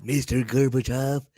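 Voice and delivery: a slow, solemn speech-giving president; Reagan impression. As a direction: deliver it like a grand presidential address. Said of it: "that's my best Reagan impression".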